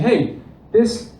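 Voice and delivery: normal talking voice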